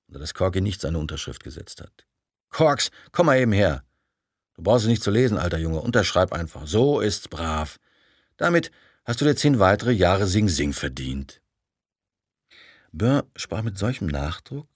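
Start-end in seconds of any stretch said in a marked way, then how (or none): 2.5-11.4 loud, clipped boss voice; imitating Roper. Burr mimics Roper ordering Corky to sign, clipped and loud. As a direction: bark like the boss mocking his lackey. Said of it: "ahmte Ropers abgehackte Sprechweise so drastisch nach".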